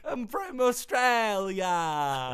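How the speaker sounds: hammed-up Aussie voice, slow; a terrible mock Australian; bad Australian accent. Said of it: "That's terrible".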